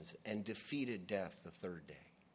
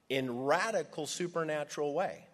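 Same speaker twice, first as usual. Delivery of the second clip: usual speaking voice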